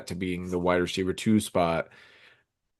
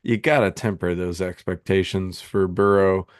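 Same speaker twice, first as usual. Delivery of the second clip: ordinary delivery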